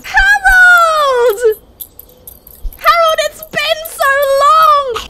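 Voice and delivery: high, loud ecstatic reunion call; joyful cry. Pretend. Bear greeting Harold with high, loud joy. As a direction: shout his name like a long-lost friend.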